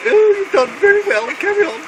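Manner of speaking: high, loud mock-royal praise; Princess Anne. Princess praises the wounded soldier, in a high hammed-up royal voice. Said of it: "She said".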